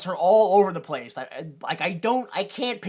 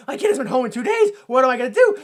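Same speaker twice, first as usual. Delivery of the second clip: higher, louder, rushed fretting; mock panic; 5 semitones higher, 7 dB louder, faster than usual. Mother fretting at home over missing kid, mock-panicked. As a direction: imitate a frantic parent, hammed-up.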